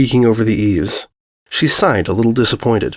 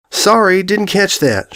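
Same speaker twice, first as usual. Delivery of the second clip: higher pitched call across the street; 8 semitones higher, slower than usual. Acted. Applejack calling back from halfway home, raised high call. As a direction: lift the voice to reach someone far off. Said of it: "she called".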